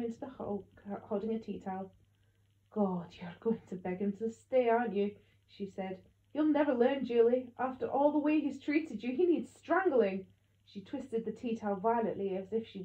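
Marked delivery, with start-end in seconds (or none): 2.7-10.3 loud, furious scolding, building to a snarl; angry scolding. A narrator's reading. Grandmother berating her daughter for taking him back, loud and furious. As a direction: bite every word, disgusted with her. Gran rails at Mum about Dad.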